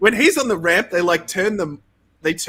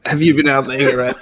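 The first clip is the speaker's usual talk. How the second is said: fast, louder hammed-up British accent; 4 dB louder, faster than usual. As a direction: lean into a mock British rock star accent. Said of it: "That's my British accent".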